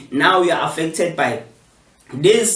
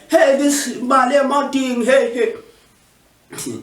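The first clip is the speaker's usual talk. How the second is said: mock Trump voice; 10 semitones higher than usual. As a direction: scoff like Trump, pitched way up. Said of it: "Trump says".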